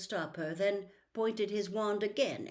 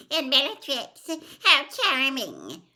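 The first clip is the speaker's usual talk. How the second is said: squeaky voice; 7 semitones higher, 10 dB louder than usual. A narrator's reading. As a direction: squeak it with a fawning simper. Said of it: "in a squeaky voice".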